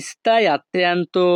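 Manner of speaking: slow, syllable by syllable "stai attento"; slow repeat. Shown as a demonstration. Teacher repeating for students, slow and careful. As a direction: spell out "stai attento" slowly for learners.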